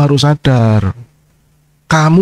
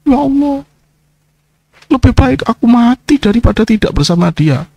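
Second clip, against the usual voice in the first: mock weeping plea; 8 semitones higher than usual. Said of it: "kamu kadung nangis-nangis".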